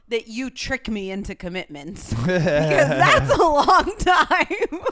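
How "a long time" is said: laughing. Real, not acted. Laughing through line.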